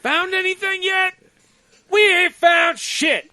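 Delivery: high shouted movie soldier voices; Spaceballs soldiers. Retold or imitated. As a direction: bark it like a dim trooper. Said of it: "This is like that part in, uh, in Spaceballs".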